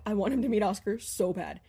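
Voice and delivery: ordinary talk, nothing marked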